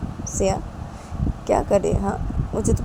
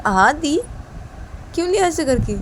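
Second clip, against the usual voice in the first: teasing; 9 semitones higher than usual. Acted. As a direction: join in the teasing. Ravi teases sister.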